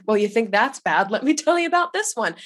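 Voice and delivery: hammed-up trump card boast; mock one-upper. Griever's listener mimicking a one-upping friend.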